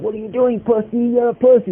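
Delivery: high mocking tease; laughing taunt. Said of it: "And he's laughing".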